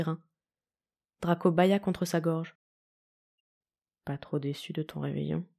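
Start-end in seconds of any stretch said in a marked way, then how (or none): 4.0-5.5 soft, low murmur against his throat. A narrator's reading. Husband lying close asks softly, low intimate murmur. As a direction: murmur it gently, half asleep. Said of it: "murmura-t-il".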